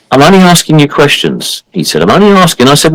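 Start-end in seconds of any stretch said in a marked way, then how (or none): 0.1-1.6 higher, whiny mock-offended voice; a higher mock-innocent whine; playing the innocent. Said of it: "started playing the innocent, the offended". 2.0-2.7 higher, whiny mock-offended voice; a higher mock-innocent whine; playing the innocent. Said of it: "playing the innocent, the offended".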